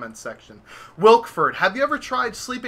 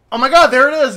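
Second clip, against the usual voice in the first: high, loud, thrilled; excited relief; 8 semitones higher, 11 dB louder, faster than usual. Retold or imitated. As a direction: burst out excited. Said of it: "I'm like".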